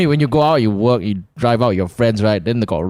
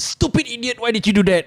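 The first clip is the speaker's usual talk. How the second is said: mock inner scream; 12 semitones higher than usual. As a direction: scold yourself in a high, squeezed pretend scream. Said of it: "the nineteen-year-old in me is screaming".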